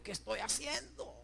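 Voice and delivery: hushed, drawn-out anxious question; inner cry to God. Retold or imitated. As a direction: voice a private doubt slowly and softly, rising at the end. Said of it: "yo decía todavía, Dios mío, por dentro".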